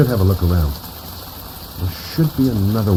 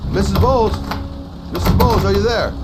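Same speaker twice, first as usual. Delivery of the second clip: high, raised calling voice; calling down a hallway; 11 semitones higher than usual. Acted. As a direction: call her name into the house. Calling into the house.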